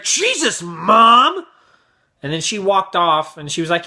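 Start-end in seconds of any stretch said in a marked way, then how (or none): 0.0-1.4 high start, loud drawn-out MOM; whine-yell it as a fed-up kid; exasperated kid. Retold or imitated. Twelve-year-old to his mom, exasperated yell. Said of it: "he was pissed that his mom did that".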